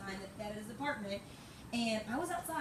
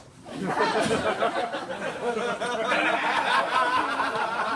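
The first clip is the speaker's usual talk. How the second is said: long laugh; 11 dB louder than usual. Real, not acted. Laughing at her own party story.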